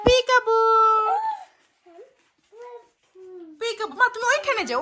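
high, loud sing-song peekaboo; playful peekaboo. Real, not acted. Peekaboo game with baby.

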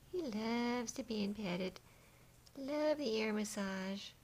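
high, slow, stretched pet talk; doting drawn-out coo. Real, not acted. Cooing over the petted cat.

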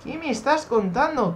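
high-pitched incredulous cry; alarmed disbelief. Real, not acted. Player still shocked by the game, high incredulous cry. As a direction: voice jumps up in disbelief.

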